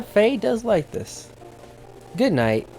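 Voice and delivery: ordinary talk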